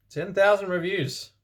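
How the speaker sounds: smug and high-pitched; boastful high. Acted. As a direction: brag it up high. Boastful author's note.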